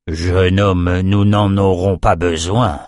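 plain reading voice

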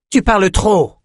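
high, sharp interjection; curt rebuke. A narrator's reading. Adessa silences the talkative fighter, curt rebuke. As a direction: cut him off with a high, impatient jab. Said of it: "lâcha Adessa".

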